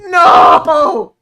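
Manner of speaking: high, loud anguished cry; outraged shout. Acted. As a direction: scream it in mock despair. Mock outrage at the news.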